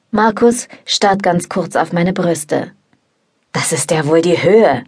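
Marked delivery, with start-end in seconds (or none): 3.5-4.8 indignant, a bit louder; mild scolding outburst; a flatmate scolds the uninvited guest, mildly indignant. A narrator's reading. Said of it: "schimpft er dann".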